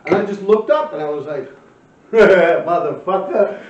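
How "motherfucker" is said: drawn-out, louder snarl of the word; squaring up to his old friend, slow and loud; slow loud curse. Retold or imitated. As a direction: stretch it out, loud and menacing.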